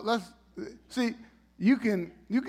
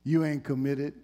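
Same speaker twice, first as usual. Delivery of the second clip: mildly scolding; mock a smug scolding look; a hint of a smug judge; smug told-you-so; 4 semitones lower than usual. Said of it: "he wasn't looking at him like".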